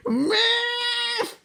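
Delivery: long, very high mock-outraged cry; worried, mad cry. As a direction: stretch the name out in high protest. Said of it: "getting a little worried and mad".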